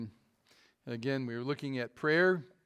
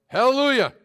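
much higher, much louder shout of Hallelujah; yelled hallelujah; 9 semitones higher, 13 dB louder than usual. Retold or imitated. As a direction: belt it out like commanding the mule to go. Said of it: "So he yelled".

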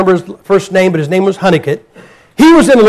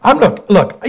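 ordinary talk

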